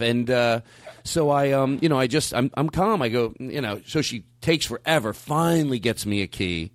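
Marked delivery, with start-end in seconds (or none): none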